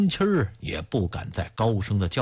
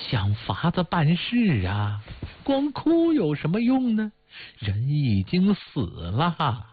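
higher-pitched, lightly voiced old woman; old widow urging sense; 6 semitones higher than usual. A narrator's reading. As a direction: plead with them to stop crying and act. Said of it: "她说出实话".

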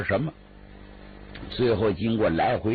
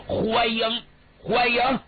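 higher, pleased welcome; lightly voiced jovial official; 10 semitones higher, slower than usual. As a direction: welcome him gladly.

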